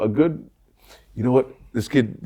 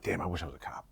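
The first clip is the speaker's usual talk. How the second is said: muttered thought; 6 semitones lower, 17 dB quieter than usual. Retold or imitated. Inner thought in court.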